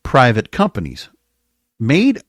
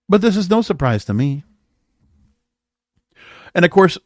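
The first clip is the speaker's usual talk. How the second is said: ordinary talk, nothing marked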